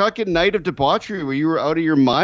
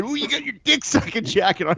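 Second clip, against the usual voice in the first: higher-pitched jeer, lightly acted; mock heckle; 7 semitones higher than usual. Friend retells hecklers at the bar, mock heckle. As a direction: imitate a bar crowd needling him. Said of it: "and people said".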